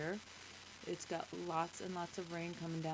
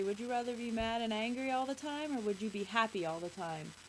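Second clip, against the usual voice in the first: ordinary delivery, nothing marked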